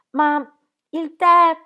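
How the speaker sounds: flustered and high-pitched; anxious protest. A narrator's reading. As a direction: protest helplessly, pitched well up. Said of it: "seguendole e gesticolando".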